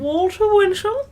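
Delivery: high, loud and slow disbelief; squeaky stunned repeat; incredulous. Real, not acted. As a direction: echo the name in shocked disbelief. Incredulous echo of answer.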